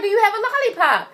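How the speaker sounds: high, quick insistent question; retold insistence. Asking my panicky sister for a lollipop, urgent insistence.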